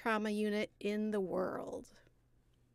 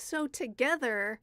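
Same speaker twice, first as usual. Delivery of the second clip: ordinary talk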